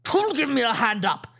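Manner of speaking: furious pirate roaring for help. A narrator's reading. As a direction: roar it at the crew. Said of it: "he roared".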